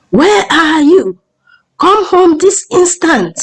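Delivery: high, slow, drawn-out scolding call; angry yell. A narrator's reading. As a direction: summon her home angrily, stretching the words. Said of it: "Mweke's angry voice caused them to stop".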